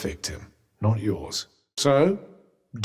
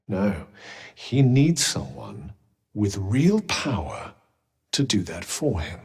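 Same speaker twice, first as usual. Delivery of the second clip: low, slow and deliberate; slow, low, ominous insistence; weighty persuasion; 6 semitones lower than usual. Acted. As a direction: drop the voice and press the point. Urges the need for power.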